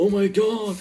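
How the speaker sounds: high, loud gasp of amazement; excited exclamation. Retold or imitated. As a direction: blurt it out amazed. Reacting to the portal.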